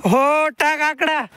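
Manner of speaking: high, slow call across the field; calling out far. Real, not acted. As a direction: stretch it out to reach someone far away. Farmer calls out to someone far.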